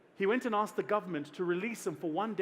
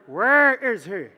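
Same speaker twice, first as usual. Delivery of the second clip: loud, slow call from down the hall; loud drawn-out summons; booming call; 3 semitones higher, 15 dB louder, much slower than usual. Retold or imitated. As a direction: boom it out like a big man calling from afar. Voice booming from the hallway.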